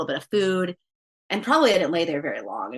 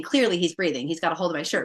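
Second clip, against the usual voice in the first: normal talking voice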